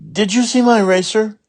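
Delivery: put-on high, anxious little girl asking; worried child voice. Ramona asks a classmate, high and anxious. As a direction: voice jumps up in worry.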